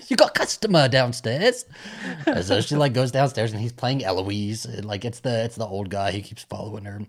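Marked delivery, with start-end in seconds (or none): 0.1-1.6 high, loud mock-Cockney call; Cockney accent. Bar boss sending the girl to a customer, mock Cockney accent. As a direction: put on a hammy Cockney, pitched up. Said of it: "the Cockney accents".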